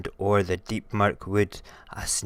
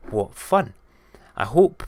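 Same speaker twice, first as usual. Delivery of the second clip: ordinary delivery, nothing marked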